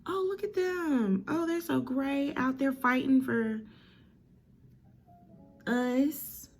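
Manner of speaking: high, drawn-out mock swooning; admiring onlooker. Villager fawning over the soldiers at the front, high admiring coo.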